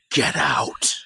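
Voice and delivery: breathy hushed dismissal; imitating Frasier's whispered get out. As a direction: hiss it under your breath, deadpan. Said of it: "he just goes".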